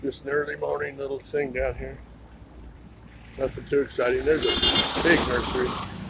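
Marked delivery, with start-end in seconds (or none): none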